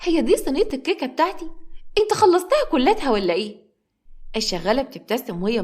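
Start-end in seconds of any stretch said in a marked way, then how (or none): 0.0-3.6 high-pitched indignant outburst; exasperated. A narrator's reading. Woman finding her cake eaten by the maid, exasperated. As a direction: demand answers in a raised, resentful pitch. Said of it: "وهي بتقول بغيظ".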